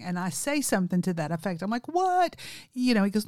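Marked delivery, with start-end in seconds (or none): 1.9-2.3 high-pitched, disbelieving question; acted-out disbelief, leaping up in pitch; incredulous. Woman doubting a prank caller, incredulous. Acting her baffled reaction to caller.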